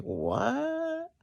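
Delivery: high, stunned "What?"; high-pitched disbelief; shocked what. Retold or imitated. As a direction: sound floored by the news. Shocked at who called.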